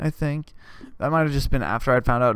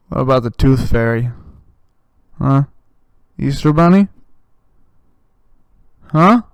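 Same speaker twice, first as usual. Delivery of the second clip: accusing demand; 7 dB louder than usual. Retold or imitated. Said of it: "I look at her and I go".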